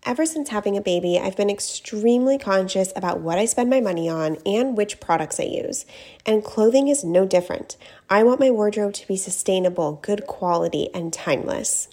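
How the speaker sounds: loud, polished personal endorsement; ad read. Acted. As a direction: pitch the brand clearly to listeners. Reads a sponsor ad.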